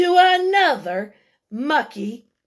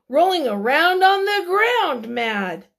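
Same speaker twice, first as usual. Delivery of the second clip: plain reading voice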